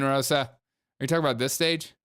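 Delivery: ordinary talk, nothing marked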